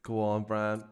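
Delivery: Northern Irish reference line; Belfast accent. Shown as a demonstration. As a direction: demonstrate a real Belfast accent. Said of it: "give us, like, a reference sentence".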